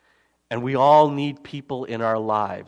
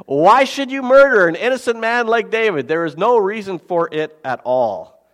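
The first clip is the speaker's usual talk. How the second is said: scripture reading; 6 semitones higher, 6 dB louder than usual. Acted. Reads Saul's rebuke, passionate.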